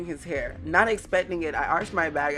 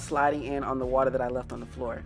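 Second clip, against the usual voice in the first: ordinary talk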